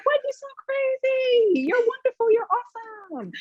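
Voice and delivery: high, gushing friend voice; cheering on a friend. Retold or imitated. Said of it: "You would be like".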